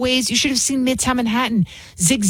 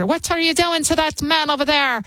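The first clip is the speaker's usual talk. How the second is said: put-on Irish accent; 6 semitones higher than usual. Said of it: "I'm trying to do my Irish".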